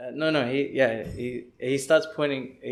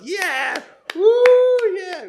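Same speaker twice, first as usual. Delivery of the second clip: high, loud crowd cheer; imitated wild cheering; erupting applause; 14 dB louder, much slower than usual. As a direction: whoop like a whole carriage celebrating. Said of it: "would just erupt in applause".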